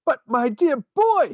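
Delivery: high, slow, thrilled outburst; bursting with excitement, high and drawn out; excited cry. A narrator's reading. Wonka to Charlie, cry out in delight. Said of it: "he cried out".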